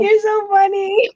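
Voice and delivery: high, slow, laughed-out words; laughing. Real, not acted. Host telling Jason he's funny, laughing. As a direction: squeeze it out through laughter.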